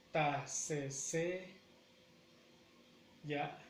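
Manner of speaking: "Ta, se, se, ya" with pauses; four separate beats, ta, se, se, ya; syllable by syllable. Shown as a demonstration. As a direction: hold back before the final ya. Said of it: "la acentuación la lleva en la".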